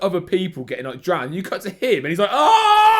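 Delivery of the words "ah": sudden high yelp of panic, laughing; a loud, high, mock-panicked yell. Guy panics, slips into water.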